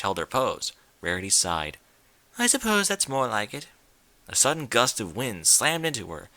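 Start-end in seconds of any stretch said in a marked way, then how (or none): 2.4-3.7 a light, refined lady voice, higher; lightly voiced refined unicorn; Rarity's voice. A narrator's reading. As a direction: play the prim fashionista gently.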